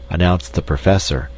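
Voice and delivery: usual speaking voice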